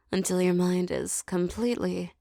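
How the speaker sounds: ordinary delivery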